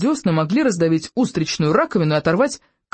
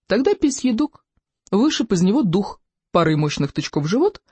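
plain reading voice

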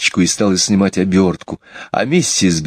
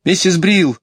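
put-on high child's call; 11 semitones higher than usual. Jane calls to the next room, high and clear. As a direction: call for the housekeeper in a raised pitch. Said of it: "позвала она".

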